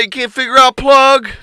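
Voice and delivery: loud, high, mocking jab; mocking taunt. Teasing a raging coworker, loud mocking jab. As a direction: taunt him about the plug. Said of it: "And I said".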